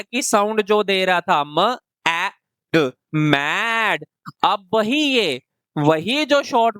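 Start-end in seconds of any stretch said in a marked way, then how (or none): 2.7-4.0 "मैड" blended slowly with a short A; short vowel word. Shown as a demonstration. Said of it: "जिसको हम short vowel की तरह पढ़ रहे थे".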